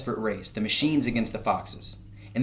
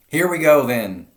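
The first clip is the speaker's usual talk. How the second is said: loud high shout of a farmer; shouting to start the digging; 6 semitones higher, 10 dB louder than usual. A narrator's reading. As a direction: bellow it over the engines. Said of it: "shouted Bean".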